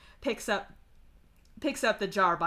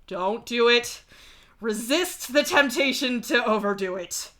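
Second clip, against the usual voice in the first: louder, rising self-restraint plea; urgent warning; 3 semitones higher, 7 dB louder than usual. Acted. Warning Strider not to overdo it, urgent. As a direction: urge yourself firmly to hold back, louder.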